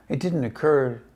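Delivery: usual speaking voice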